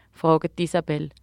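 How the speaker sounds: usual speaking voice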